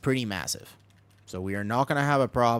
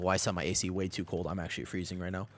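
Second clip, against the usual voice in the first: usual speaking voice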